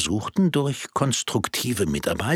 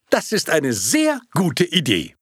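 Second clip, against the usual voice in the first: eager projectionist; 8 semitones higher than usual. Acted.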